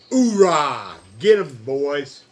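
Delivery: booming military battle cry; loud gung-ho soldier. Pretend. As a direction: yell it like a drill sergeant. Cheering on the Marines.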